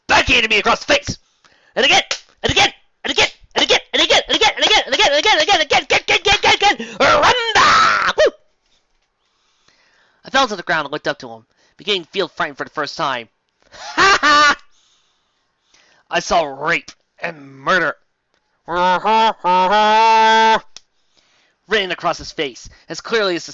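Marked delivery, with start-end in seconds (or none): 0.1-7.0 higher and louder each time; escalating hammy shout. Acted. Mock-dramatic repetition. 7.0-8.3 party shout. Real, not acted. Cheering shout. 13.7-14.6 evil laugh. Acted. Villain laugh. 18.7-20.8 evil cackle. Acted. Villain cackle.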